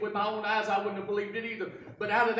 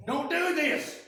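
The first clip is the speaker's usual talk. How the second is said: pleading protest; 6 semitones higher, 4 dB louder, slower than usual. Acted. Disciple protests rolling away stone.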